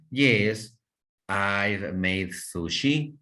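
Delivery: slow, careful model sentence with sushi rising; slow teacher demo of the correct form; correct model answer. Shown as a demonstration. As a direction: spell out the right answer slowly for learners.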